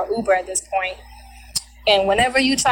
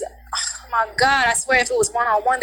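ordinary delivery

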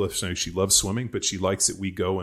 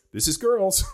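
higher resigned sing-song; mock wife shrug; 12 semitones higher, 5 dB louder than usual. Husband imitating his wife's shrug about daughters, higher and resigned. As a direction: toss it off like a shrug.

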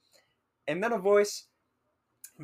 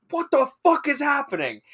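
high, loud burst of bewildered panic; retold panicked outcry; 12 semitones higher, 6 dB louder than usual. Student reacting to someone kicking the door, bewildered panic. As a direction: cry it out in mock panic.

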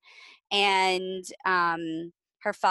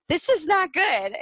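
scolding parent; 9 semitones higher, 6 dB louder than usual. Retold or imitated. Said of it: "you're always there telling your kid".

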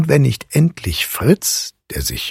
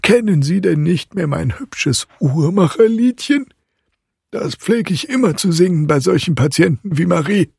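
high, jovial character voice; amused godfather; 9 semitones higher than usual. A narrator's reading. Godfather explains his little clockmaker song, high and amused. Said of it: "erwiderte Drosselmeier lachend".

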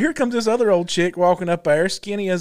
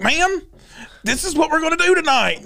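higher, insistent repeat; imitating an officer standing firm; insistent retold officer; 10 semitones higher than usual. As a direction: talk over a screamer, pitched up. Officer repeats over her screaming.

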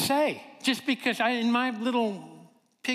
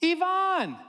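high delighted cry of a name; exclaiming; 11 semitones higher than usual. Retold or imitated. Leah seeing her friend's photo, high happy exclamation. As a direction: shout the name in glad recognition. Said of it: "Leah exclaimed".

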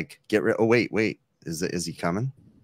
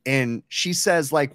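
normal talking voice

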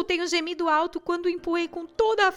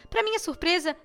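plain reading voice

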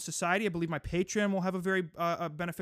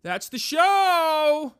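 announcer shout; 9 semitones higher, 9 dB louder, much slower than usual. Acted. Closing bit of rant segment.

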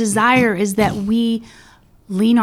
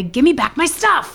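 high, playful call-out in character; raised, hammed-up demand; mock demand; 8 semitones higher, 4 dB louder than usual. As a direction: belt it out like a catchphrase. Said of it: "you'll hear me say".

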